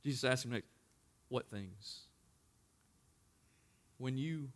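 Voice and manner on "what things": soft, low "What things?" asked gently; quiet question. Acted. Jesus asks quietly.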